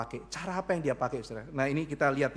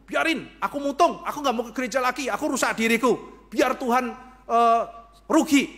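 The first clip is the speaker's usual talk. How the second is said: louder and higher, a sulking tantrum; mock tantrum; 6 semitones higher, 6 dB louder than usual. A believer sulking at God, petulant and loud. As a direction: whine it like a spoiled child.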